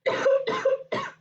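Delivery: harsh cough from a strained throat; real coughing fit. Real, not acted. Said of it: "I need to stop with the Vernon voice".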